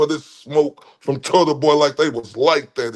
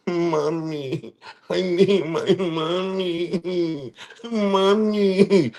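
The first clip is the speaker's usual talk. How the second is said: whiny mock child; 3 dB quieter, much slower than usual. Mocking opponent crying for mommy.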